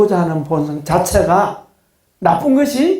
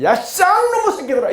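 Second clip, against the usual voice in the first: hammed-up cursing yell, much higher, half laughing; mock angry outburst; 14 semitones higher than usual. Pastor to congregation, joking about scolding disciples, hammed-up yell. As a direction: play a pastor faking a rude outburst at bickering disciples. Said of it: "거기다 놈자를 붙여".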